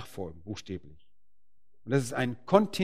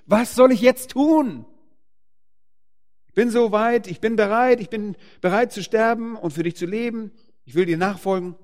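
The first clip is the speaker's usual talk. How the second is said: higher and louder, pleading and ready; eager plea; 9 semitones higher, 7 dB louder than usual. Acted. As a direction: cry out eagerly, willing to give everything. Voicing a convert's eager surrender.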